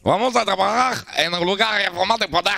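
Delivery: high-pitched, hammed-up Spanish accent; exaggerated fake Spanish accent; mock Spanish accent. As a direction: play a Spanish host greeting the lads. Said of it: "Comment on fait l'accent espagnol".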